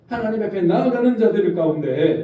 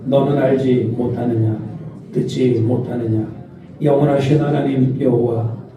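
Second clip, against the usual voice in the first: slow, lower read-aloud in unison; leading a slow, steady group reading of the verse; slow unison scripture reading; 6 semitones lower, slower than usual. Shown as a demonstration. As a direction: read slowly together with the congregation. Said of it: "이십팔 절 시작".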